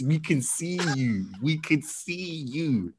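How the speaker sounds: very slow, drawn-out, softened call; slow-motion imitation of a discreet warning; mock stage warning. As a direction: stretch every word, keeping it hushed and a bit high. Acting out interview viewers' warning.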